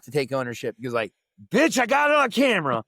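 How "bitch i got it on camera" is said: sassy callout. Retold or imitated. Sassy quoted callout on camera.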